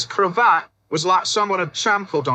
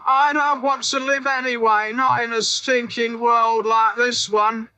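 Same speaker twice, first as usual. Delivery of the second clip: put-on old drunk creaking; 7 semitones higher than usual. A narrator's reading. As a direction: dare them to finish him off. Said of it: "started to creak".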